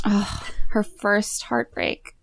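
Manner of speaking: slow, whispery mock sympathy; mock-tragic whisper. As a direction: sigh it out slowly in pretend heartbreak. Mock-tragic reaction to heartbreak scene.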